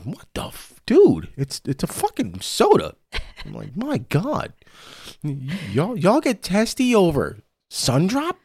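retelling his own shocked reaction, voice shooting high; incredulous outburst. Retold or imitated. To his boss over soda, high disbelieving outburst. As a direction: pitch climbing way up in disbelief, ending on a high yelp.

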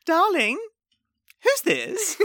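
high, sugary possessive darling; mock Emily. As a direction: lay claim sweetly.

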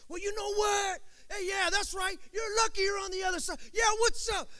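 high-pitched blustering mock shout; mock big talk. Preacher imitating a friend who talks big from afar, hammed-up shouting. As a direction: puff it up like an all-talk tough guy. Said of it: "those people that talk big".

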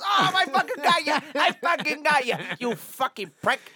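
hammed-up gangster blowup; mobster rage. Quoting a Goodfellas scene to a friend, loud hammed-up mobster yelling. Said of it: "He goes".

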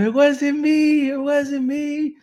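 high, slow, stretched wail of denial; dad's hammed-up high wail; mock yell. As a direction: plead it out long and high. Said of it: "Está gritando".

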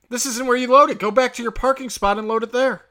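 retold scolding. Driver sending a loader back to his spot, retold loud and high. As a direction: re-enact ordering someone off. Retold parking confrontation with a stranger.

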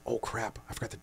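soft under-the-breath grumble; quiet self-scolding in a dark theater; muttered to self. Retold or imitated.

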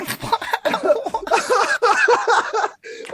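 high-pitched fit of laughter; high squealing laugh. Real, not acted. Laughing at own verdict.

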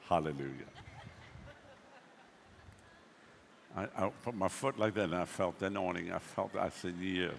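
low, soft, devout preaching; hushed reverent preaching. Real, not acted. Recounting the anointing in a hushed voice.

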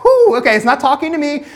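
high, loud laughing relief; relieved laugh. Retold or imitated. Pastor relieved audiobook wasn't about him, laughing. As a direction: laugh off the scare with a big whew. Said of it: "I'm like".

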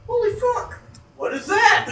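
high-pitched startled outburst; shocked. Retold or imitated. Bob spotting the creature behind a tree, high startled outburst. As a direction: jump up in pitch like you've just spotted a monster. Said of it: "he's like".

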